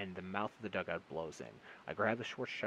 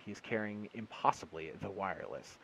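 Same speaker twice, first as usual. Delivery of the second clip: ordinary delivery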